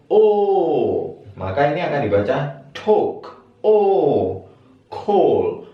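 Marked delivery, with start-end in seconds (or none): 0.1-1.1 "O" held long, high and loud. Shown as a demonstration. Teacher showing the British long O, high and loud. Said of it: "O-nya tinggi". 3.6-4.4 "O" held long, high and loud. Shown as a demonstration. Teacher showing the British long O, high and loud. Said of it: "O-nya tinggi".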